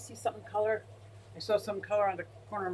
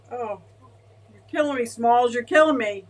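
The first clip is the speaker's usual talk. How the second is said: overwhelmed; 2 semitones higher, 7 dB louder than usual. Real, not acted. Overwhelmed by a big donation.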